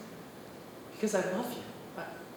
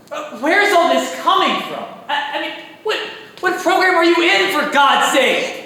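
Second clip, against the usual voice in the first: much louder and higher, baffled; exasperated shout; 8 semitones higher, 12 dB louder than usual. Acted. Adam shouts back at JC, exasperated and baffled. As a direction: yell it in disbelief.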